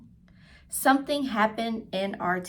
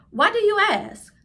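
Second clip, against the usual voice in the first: high voiced question; 12 semitones higher, 8 dB louder than usual. Mother answers Josh.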